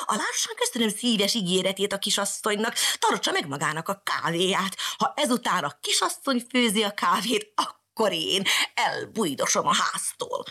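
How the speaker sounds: high, huffy cat voice; offended cat. Acted. Angry cat refusing the coffee, huffy high voice. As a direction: sniff at her like a proud cat.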